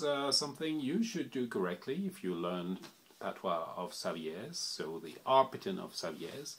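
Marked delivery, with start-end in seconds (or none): none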